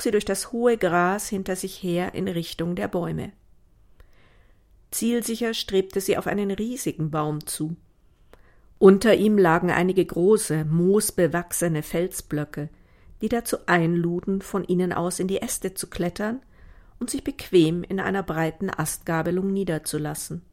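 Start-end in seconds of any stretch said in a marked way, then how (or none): none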